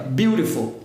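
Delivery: "beautiful" with a tapped R for the T; "beautiful" said like "biurifol"; flap T. Shown as a demonstration. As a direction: tap the tongue once in "beautiful". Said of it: "Som de R nele".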